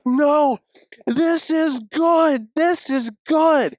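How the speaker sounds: high, stretched-out insistence; hyped-up urging over the noise; retold excited reassurance. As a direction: draw out each phrase in a high, thrilled voice.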